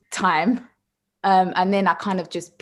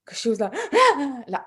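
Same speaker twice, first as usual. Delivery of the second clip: usual speaking voice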